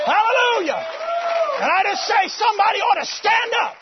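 loud, high hallelujah and call to stand; rally the church to stand up; shouted praise. Acted. Preacher urging his congregation, loud and high.

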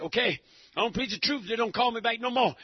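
ordinary delivery

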